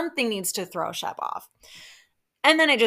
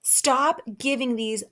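slow, emphatic scolding building to a loud stop; worked up, drag out each phrase; exasperated plea, punched at the end; heated; 4 dB louder, much slower than usual. Real, not acted. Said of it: "I know I'm heated".

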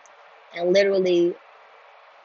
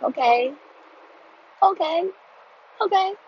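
each okay rising higher, mock agreeable; sarcastic okays; 9 semitones higher than usual. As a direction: humor her, climbing up. Humoring the angry girl.

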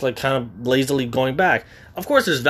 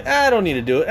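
lazy self; 5 semitones higher, faster than usual. Retold or imitated. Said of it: "The next day".

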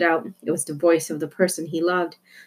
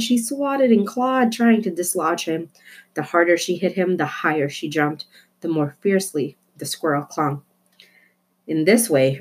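plain reading voice

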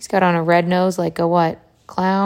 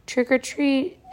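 high, sing-out doorstep call without real volume; mild shout for a trick-or-treater; storybook shout; 6 semitones higher than usual. A narrator's reading. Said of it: "shouted a scary skeleton".

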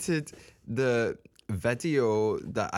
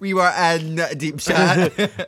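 slow, higher, louder mock repeat; laughing echo; 5 semitones higher, 6 dB louder, slower than usual. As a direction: draw it out through laughter. Co-host mockingly echoes her line, laughing.

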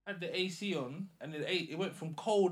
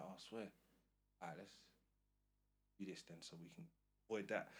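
calm low mutter; 7 semitones lower, 15 dB quieter, much faster than usual. Retold or imitated. Calm amid madness.

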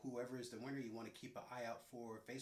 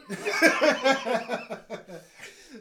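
loud laugh; 14 semitones higher, 23 dB louder than usual. Real, not acted. Laughs at being unwell.

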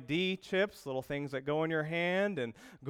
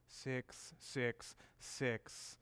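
very slow, much softer, ominous; ominous slow count; 10 dB quieter, much slower than usual. Retold or imitated. Coworker revealing the hidden number, slow and ominous. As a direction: draw out each six like a horror film. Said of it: "he said".

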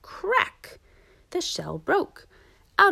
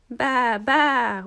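loud, raised storybook baa; sheep bleat; 5 semitones higher, 8 dB louder than usual. A narrator's reading. Big woolly duck answers Webster, loud bleating. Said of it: "went the big woolly duck".

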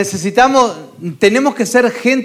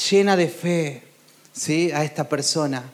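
soft gentle inner voice; 5 semitones lower, 7 dB quieter than usual. Acted. Holy Spirit's gentle voiced words.

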